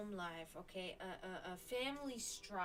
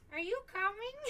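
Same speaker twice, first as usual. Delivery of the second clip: high, louder meowy Are you coming?; talking cat imitation; 10 semitones higher, 6 dB louder than usual. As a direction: play the cat calling through the door. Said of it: "you hear the cat say".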